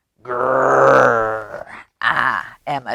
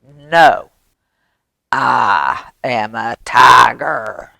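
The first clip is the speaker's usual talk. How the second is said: low, slow, emphatic tiger; tiger's correction; 3 semitones lower, 4 dB louder, much slower than usual. Acted. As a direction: draw it out firmly as the real tiger. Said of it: "He comes and he says".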